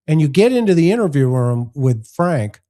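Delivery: ordinary talk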